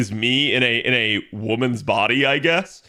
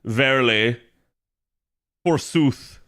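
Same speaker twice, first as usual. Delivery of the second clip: put-on old English words. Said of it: "ye old English".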